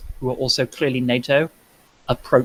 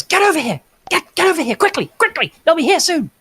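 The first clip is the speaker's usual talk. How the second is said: high-pitched urgent call to hurry over; 4 dB louder than usual. Acted. Soldier waving two runners across the road, urgent high call.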